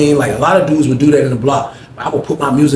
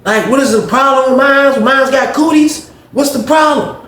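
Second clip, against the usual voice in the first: much higher, pouty complaint; Greg's high whiny voice; 11 semitones higher, 3 dB louder than usual. Retold or imitated. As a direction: whine high, slowing on cooties. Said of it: "He's like".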